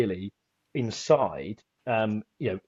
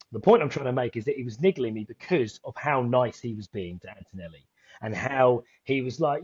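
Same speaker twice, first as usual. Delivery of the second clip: ordinary talk, nothing marked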